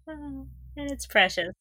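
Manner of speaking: ordinary talk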